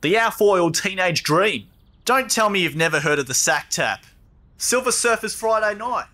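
reeling off nicknames. Acted. Listing names for the wine bag to a puzzled mate, slow proclaiming.

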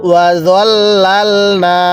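long drawn-out chanted recitation, every vowel stretched; slow Quran recitation. Shown as a demonstration. Teacher models the verse for students, slow drawn chant. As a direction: intone it slowly, as the teacher's model reading. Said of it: "তাহলে কিভাবে পড়তে হবে?".

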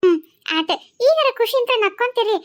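usual speaking voice

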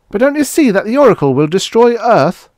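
high, loud alarmed appeal; desperate protest. A narrator's reading. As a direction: plead in alarm to make them see. Acted argument, desperate warning.

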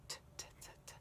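unvoiced "t" tapped with the tongue tip; whispered t. Shown as a demonstration. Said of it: "really just focusing on the".

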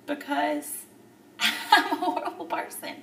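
a rueful tearful laugh, slowed down; laughing through tears. Real, not acted. Telling viewers she's a horrible person, laughing through tears. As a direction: laugh at yourself while close to crying.

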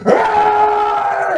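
high charging yell; battle scream. Acted. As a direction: scream like a berserker. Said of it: "As he's going".

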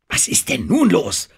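laut und hoch nachgefragt; aufgebrachte Frage. Acted. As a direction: verlange aufgeregt eine Erklärung. Denham reagiert alarmiert auf Geschrei. (ungeduldig gerufene Frage)

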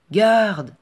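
high, loud cry for the guard; shouted call. Acted. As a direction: bellow for the guard across the ruins. Falconer calls the guard.

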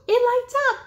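high, loud show of wonder; gasp, then exclaim in big amazement; amazed gasp. Pretend. Amazed at toy lighting up.

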